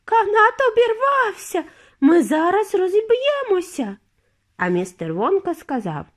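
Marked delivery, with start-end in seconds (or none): 0.1-4.0 high, loud terrified cry; panicked scream. A narrator's reading. Mrs TV screaming that the cable snapped, high and panicked. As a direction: shriek in panic at the fall. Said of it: "А пані Тіві закричала".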